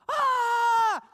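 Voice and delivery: high, loud frightened shriek; wife screaming while swinging a broom. Retold or imitated. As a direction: scream as the bat swoops. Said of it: "twenty minutes of screaming".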